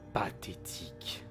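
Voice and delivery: low, slow raspy sneer; slow ominous raspy drawl. Acted. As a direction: drawl it out with a rasp. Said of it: "entendez une voix rauque qui lâche un petit ricanement".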